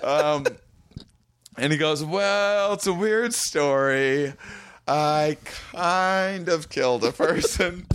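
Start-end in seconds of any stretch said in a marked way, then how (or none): 2.0-7.9 high, drawn-out awkward admission; imitating a sheepish confession; hesitant confession. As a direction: ease into it reluctantly, stretching the words. Driver's hesitant confession, acted out.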